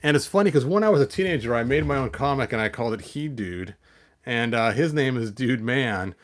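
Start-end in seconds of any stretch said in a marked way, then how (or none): none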